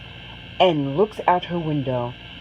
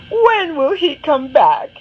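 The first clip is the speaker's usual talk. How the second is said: higher, louder whining question; Anna begs her mother once more; exasperated child; 8 semitones higher, 6 dB louder than usual. Acted. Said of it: "she asks her mother".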